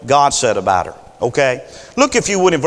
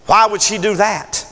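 normal talking voice

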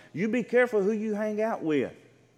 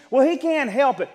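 higher, louder imitation of an excuser; mock excuse-making; 6 semitones higher, 5 dB louder than usual. People excusing a sinner's behaviour, mock protest. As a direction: imitate someone protesting on another's behalf.

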